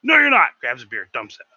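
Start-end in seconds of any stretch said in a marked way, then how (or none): 0.0-0.5 high, loud flat no; loud refusal. Retold or imitated. Chef refusing to let the cook wait, loud refusal. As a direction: shut him down hard. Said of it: "he's like".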